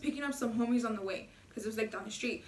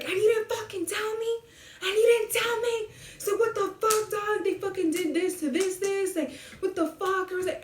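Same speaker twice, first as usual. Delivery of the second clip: high-pitched, agitated retold rant; outraged imitation; 8 semitones higher than usual. Brother angry nobody told him about those fools, acted out as outrage. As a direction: mimic a worked-up brother demanding answers.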